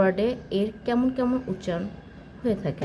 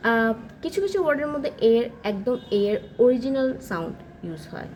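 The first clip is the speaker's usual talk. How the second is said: ordinary talk, nothing marked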